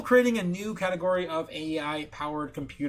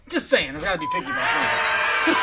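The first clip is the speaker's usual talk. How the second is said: higher and louder, through laughter; laughing shout; 4 semitones higher, 6 dB louder than usual. Real, not acted. Laughing at himself for nitpicking the speaker design. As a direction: crack up at your own pickiness.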